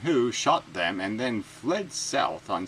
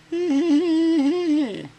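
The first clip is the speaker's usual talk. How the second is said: high-pitched cackling laugh; 7 dB louder than usual. Real, not acted. Laughing at own impression of the spokesman, high.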